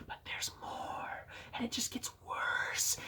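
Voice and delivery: hushed, mock-ominous whisper. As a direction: whisper it like a dark secret.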